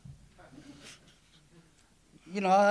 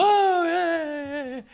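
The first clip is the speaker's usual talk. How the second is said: excited cheer; 13 semitones higher, 6 dB louder, much slower than usual. Real, not acted. God raised up a deliverer.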